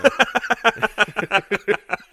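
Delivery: loud, high burst of laughter; hearty laughter. Real, not acted. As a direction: crack up for a couple of seconds. Cracks up at wrestler's shtick.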